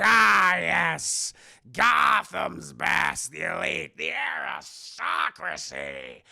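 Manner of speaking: slow, loud, raspy villain speech; hammed-up monologue, building to a loud stretched finish; Danny DeVito's Penguin. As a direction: draw each phrase out in a growling, gloating rasp. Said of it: "Danny DeVito's penguin's like".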